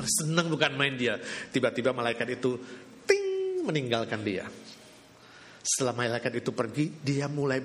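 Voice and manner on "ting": high bright chime voiced; ping like a magic bell; magic ting sound. Angel vanishing with a sung magic chime.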